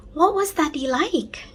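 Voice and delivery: put-on a small boy wondering; high curious child; curious little boy. A narrator's reading.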